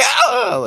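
sudden high cartoon yelp; a short isolated cartoon cry. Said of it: "they would just do, like, isolated".

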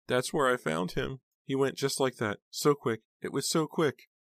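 very high, distressed lament; sobbing aunt. A narrator's reading. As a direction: repeat it in high-pitched, stunned grief. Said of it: "still sobbing".

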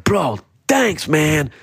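high, eager thanks; all excited. Retold or imitated. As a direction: gush with disbelief, pitch climbing. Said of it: "I get all excited".

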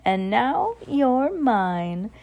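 slow, sly witch drawl; mischievous witch. Acted. Winifred taunting the crowd, slow and mischievous. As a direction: savour it like a scheming witch. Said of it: "said Winifred with a mischievous smile".

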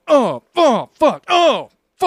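loud pained oh oh fuck; pained groaning. Retold or imitated. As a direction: groan out in pain. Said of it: "but you're like".

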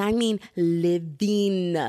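drawn-out, emphatic "living"; stress on living. Real, not acted. Podcaster praising a TikTok trend, stress on "living". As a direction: stretch "living" slowly for emphasis.

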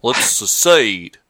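put-on lazy drawn-out Texas accent, slowed right down. Podcast host mocking Texans who want to secede, in a Texas accent. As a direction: ham up a Texan rallying his buddies. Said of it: "That's my Texas, accent".

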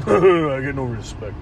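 low, slow catchphrase impression; comedian's catchphrase in a low put-on voice; Rodney Dangerfield. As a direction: drop low and drag out the complaint. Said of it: "with, uh, Rodney Dangerfield".

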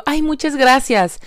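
high, bright gracias to a stranger; sweet thanks. Retold or imitated. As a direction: thank him sweetly, pitch up. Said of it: "y yo".

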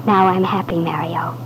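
soft whisper, breath only; whispered contentment. Acted. Wife in her husband's arms, whispering contentedly. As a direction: whisper it against his shoulder.

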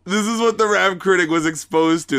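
amused, laughing. Real, not acted. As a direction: grin through the words. Laughing about childhood tape.

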